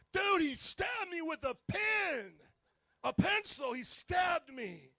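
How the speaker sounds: high, drawn-out outraged shout; slow, loud, hammed-up screaming. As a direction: wail in outrage as the victim. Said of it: "I hear Glenn screaming".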